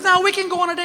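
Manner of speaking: high, insistent wife; wife insisting. Retold or imitated. Wife insisting on a date, high pitched. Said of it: "She goes".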